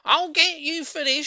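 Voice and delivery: high, loud threatening yell; furious shout. Acted. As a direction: scream the threat at him. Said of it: "shouted Missus Twit".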